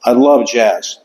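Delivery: ordinary talk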